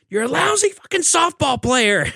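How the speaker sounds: high, loud shouted insult; mock yell. Cruise yelling the insult at Bacon as he leaves, high and loud. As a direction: yell the insult after him at the top of your voice.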